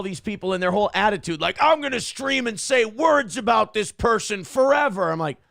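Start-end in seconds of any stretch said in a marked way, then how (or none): 1.6-5.1 mock ranter. Imitating the ranting streamers.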